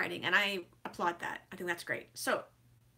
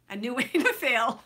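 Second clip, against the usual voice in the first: louder, laughing through the last words; laughing punchline; 5 semitones higher, 9 dB louder than usual. Real, not acted. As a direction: let the laugh break into the punchline. Punchline about failing, laughs.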